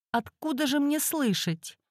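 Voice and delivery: high, quiet, bewildered woman; timid and a little lost; timid client. Acted.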